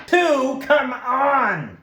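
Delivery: shouted, high-pitched cheer; excited. Real, not acted. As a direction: yell at the dice to roll well. Rooting for a dice roll.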